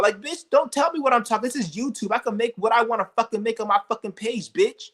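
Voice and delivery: outraged shouting at haters; furious rant. Real, not acted. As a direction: tear into the critics at full heat.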